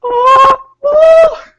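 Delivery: retold shrieks; panicked scream. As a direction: flee the wasps. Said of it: "we're like".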